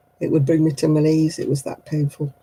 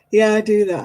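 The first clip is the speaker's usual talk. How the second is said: ordinary delivery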